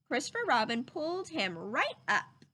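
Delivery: usual speaking voice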